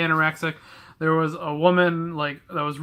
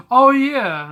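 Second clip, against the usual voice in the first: mock surprised; 8 semitones higher, 7 dB louder than usual. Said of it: "I was like".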